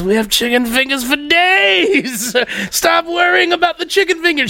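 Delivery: mimicking a drunk buddy brushing off the fuss; drunk Mike. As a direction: play the drunk friend waving it off, laughing. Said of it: "what a drunk Mike is trying to explain".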